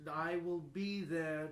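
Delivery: ordinary talk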